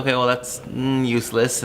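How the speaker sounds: usual speaking voice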